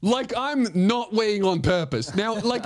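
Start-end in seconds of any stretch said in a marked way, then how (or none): none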